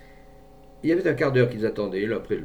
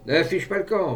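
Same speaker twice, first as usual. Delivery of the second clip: raised, louder warning to a fugitive; threatening; 7 semitones higher, 6 dB louder than usual. A narrator's reading. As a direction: threaten someone running away. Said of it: "menaça l'homme".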